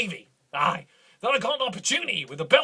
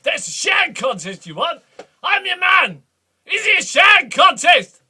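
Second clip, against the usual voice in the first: higher, louder bellowing challenge; shouting; 7 semitones higher, 8 dB louder than usual. Acted. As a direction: roar the offer at him. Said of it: "The Duke hasn't begun shouting yet".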